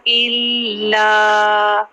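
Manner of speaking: इल्ला with a doubled ल held, slow; Qur'anic recitation. Shown as a demonstration. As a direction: hold the ल in इल्ला. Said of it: "थोड़ा सा hold करना है".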